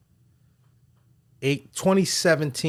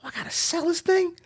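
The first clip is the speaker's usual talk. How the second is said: surprised realization; 4 dB quieter than usual. Retold or imitated. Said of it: "So I'm like".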